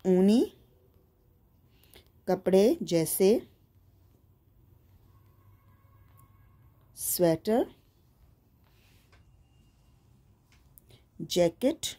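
slow single words, long gaps between; writing-along dictation. Real, not acted. Teacher writing out winter clothes for pupils, slow halting dictation. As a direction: dictate the list one word at a time. Writing the next answer aloud.

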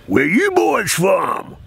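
slow loud Southern shout; hammed-up Southern drawl demanding where from; Southern storekeeper calling out to strangers; Mississippi drawl. Store owner voiced in story.

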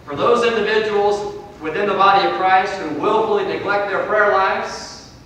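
loud, high pulpit warning; forceful preaching. Real, not acted. Preacher warning those who neglect prayer, forceful preaching. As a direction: stretch the closing words for weight.

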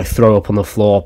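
ordinary talk, nothing marked

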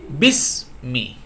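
বিসমি stretched slowly, no hamza sound at the start; slow demo. Shown as a demonstration. Teacher giving students an example word, slow careful demo. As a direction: draw out বিসমি slowly as in recitation. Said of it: "যেমন".

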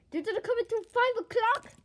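loud and high, rising on five. Acted. Friend repeats it to Bonnie, loud and high. Repeats louder after voice crack.

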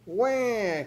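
high-pitched infant wail; baby ghost yell. A narrator's reading. Baby ghost yelling at Gus, high baby wail. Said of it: "cried baby ghost".

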